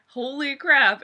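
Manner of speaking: ordinary talk, nothing marked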